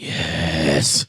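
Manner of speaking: low, drawn-out "yes"; long hissed-out, satisfied yes; relishing yes. Retold or imitated. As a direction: savor it deep and long. Acting own delighted reaction to video.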